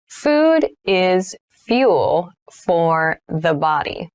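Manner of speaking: slow, word-by-word teacher demo; slow model read. Shown as a demonstration. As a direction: spell it out slowly for learners to repeat. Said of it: "teacher repeats sentence, then slows".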